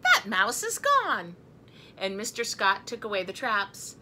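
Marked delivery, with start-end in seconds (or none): 0.0-1.4 high, loud, slow declaration; the Scotts announcing good news; relieved announcement. A narrator's reading. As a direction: proclaim it slowly in a raised voice. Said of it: "The Scott said".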